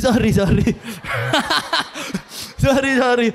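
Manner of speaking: real laugh breaking through sorry; laughing apology. Real, not acted. Preacher apologising to congregation after scolding them, laughing. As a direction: apologise while cracking up.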